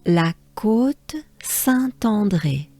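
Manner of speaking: "La Côte Saint-André" slowly in careful French, T linked; slow teacher's French on "La Côte Saint-André"; whole French name. Shown as a demonstration. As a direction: model "La Côte Saint-André" in one breath, unhurried. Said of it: "it goes like this".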